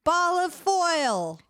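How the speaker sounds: slow and high, silly. Acted. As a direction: drag it out goofily. Game word.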